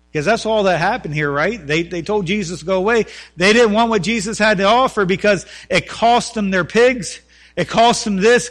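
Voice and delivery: loud, rising sermon delivery; fervent preaching. Acted. Preacher pleading with his church, loud and impassioned. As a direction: pastor urging the congregation, preaching loud and fervent.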